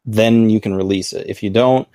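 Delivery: ordinary talk, nothing marked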